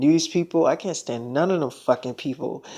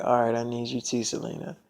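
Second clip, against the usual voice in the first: much softer, tender reassurance; soft tender reply; 5 semitones lower, 8 dB quieter than usual. Retold or imitated. Boyfriend answering Selena's plea, soft and tender. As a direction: answer her gently, almost under breath.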